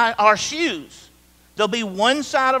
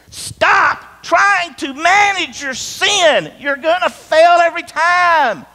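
higher, louder, slow, hammering each word; earnest shout; 8 semitones higher, 6 dB louder, slower than usual. Real, not acted. Preacher urging his church to stop managing sin, a slow earnest shout. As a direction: plead hard with them to stop.

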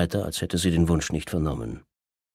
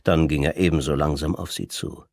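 ordinary delivery

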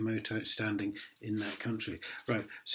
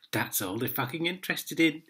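ordinary delivery

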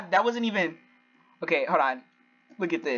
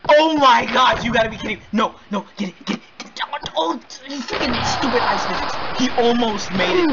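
raised, high-pitched outburst of disbelief; loud frustrated gamer outburst; too loud; 6 semitones higher, 3 dB louder than usual. Real, not acted. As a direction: cry out in exasperation at the game. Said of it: "I think I'm a little bit too loud".

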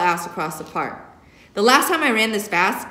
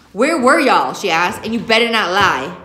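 angry interrogation; 7 dB louder than usual. Acted. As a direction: warn them not to lie. Mother interrogating kids.

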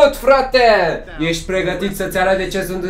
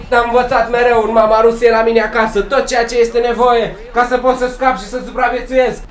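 ordinary delivery

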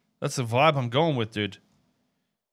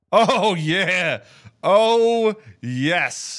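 excited; 4 semitones higher, 10 dB louder, much slower than usual. Real, not acted. Delighted by character's look.

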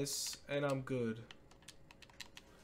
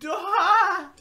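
startled cry; 10 semitones higher, 9 dB louder than usual. Real, not acted. Reacting to game play.

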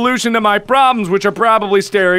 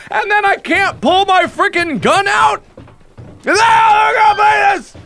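high-pitched frustrated hollering; exasperated rant; 9 semitones higher than usual. Real, not acted. Player who couldn't pull the gun out, exasperated yelling. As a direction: rail at your own botched move. Rants after failing in game.

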